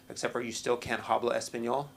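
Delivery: ordinary talk, nothing marked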